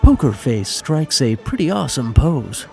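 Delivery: normal talking voice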